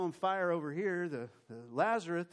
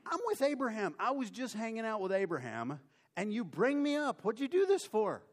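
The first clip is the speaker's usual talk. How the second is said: high, loud indignant complaint at being disturbed; Samuel annoyed; 7 semitones higher, 4 dB louder than usual. Acted. Samuel complains to Saul for calling him up, high and indignant. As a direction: whine it out, high and put out.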